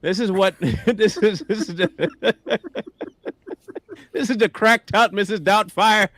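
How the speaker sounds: laughing high and loud; can barely get it out for laughing. Real, not acted. Laughing while describing the author.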